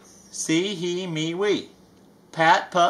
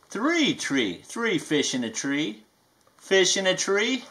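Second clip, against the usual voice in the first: slow, sing-song picture book reading; reading to children; 4 dB quieter than usual. A narrator's reading. Reading a rhyming book to kids, sing-song. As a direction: read each rhyme to a little one.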